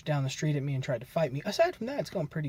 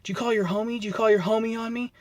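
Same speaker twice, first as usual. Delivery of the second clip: higher-pitched mock yell; retold yelling; 7 semitones higher, 4 dB louder than usual. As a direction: imitate an accusing yell without full volume. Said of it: "is yelling at me".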